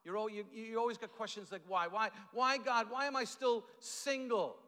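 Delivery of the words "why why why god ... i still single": higher, louder plea of whys to God; mock pleading. Single churchgoer questioning God, mock pleading. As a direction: plead upward, drawing out the last question. Voicing a frustrated single person's prayer.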